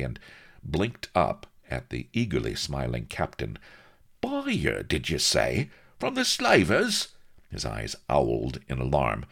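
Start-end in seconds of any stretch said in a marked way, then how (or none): 4.2-7.1 high, squeaky disbelief; shocked at the idea; alarmed. A narrator's reading. Vladimir alarmed.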